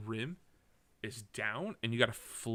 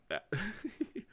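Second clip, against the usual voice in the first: high giggle rising in pitch; 10 semitones higher than usual. Real, not acted. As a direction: laugh at your own fumble, high. Laughs at own fumbled word.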